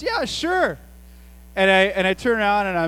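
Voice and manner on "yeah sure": much higher, breezy agreeing; cheery yeah sure. Retold or imitated. Dad agreeing to kids' dinner guests, breezy and high. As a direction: toss off an easy yes, way up high. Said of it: "my response is always".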